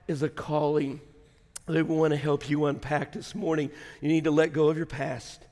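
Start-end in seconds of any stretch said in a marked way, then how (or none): none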